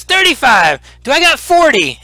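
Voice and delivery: high auction call; excited auctioneer. Acted. Goblin auctioneer calling bids.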